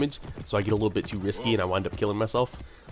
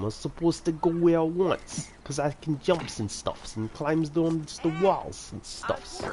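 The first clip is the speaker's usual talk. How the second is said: hammed-up New York accent, higher and slow; mock New York accent; 8 semitones higher, slower than usual. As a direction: play a goofy New Yorker with a New York accent, slowly. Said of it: "that accent".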